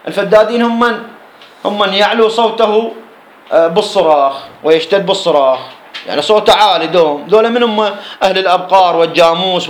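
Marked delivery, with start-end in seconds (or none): none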